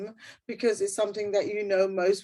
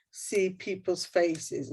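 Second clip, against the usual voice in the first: ordinary talk, nothing marked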